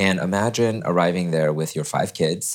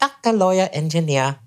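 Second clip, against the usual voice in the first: high, mock parental listing of careers; teasing imitation of Chinese parents; 11 semitones higher than usual. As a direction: rattle off the approved jobs like a strict parent. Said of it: "they're like".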